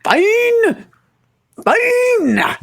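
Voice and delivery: very high squeaky surrender; squeaky sulk. Acted. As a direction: squeal it like a cornered little man. Rufus gives in.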